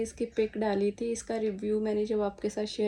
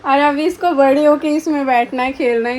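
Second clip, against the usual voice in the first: words spilling out through real laughter, louder; laughing while talking; 4 semitones higher, 13 dB louder than usual. Real, not acted. Mother laughing at her toddler in the walker, talking through laughter. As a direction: laugh as you speak.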